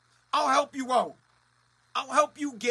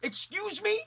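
mock confrontation; 9 semitones higher, 6 dB louder than usual. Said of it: "I wanna be like".

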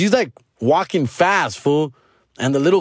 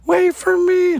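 put-on high, slow whiny little kid pleading; high kid voice begging mom to wait; crying toddler voice; 3 dB louder, slower than usual. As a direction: squeak it out like a tired toddler left behind. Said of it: "behind her, like".

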